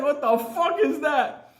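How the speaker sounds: high, slow, startled retold cry; alarmed exclamation. Driver reacting to screams in the back, alarmed.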